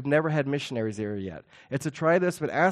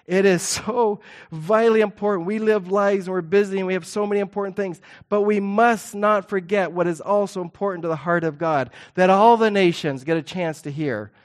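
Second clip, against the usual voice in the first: preaching with force; 5 semitones higher, 5 dB louder than usual. Real, not acted. Preacher's passionate emphasis.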